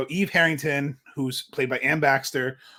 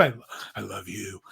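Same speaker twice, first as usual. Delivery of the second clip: lower, much softer intense confession; creepy devotion; 8 semitones lower, 9 dB quieter than usual. Retold or imitated. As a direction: breathe it like an obsessed fan. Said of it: "Like the very classic".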